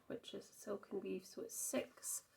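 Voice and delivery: normal talking voice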